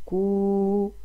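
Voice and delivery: كو said slowly with its oo held out; sounding out. Shown as a demonstration. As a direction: hold the oo of كو. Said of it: "هنا صوتها".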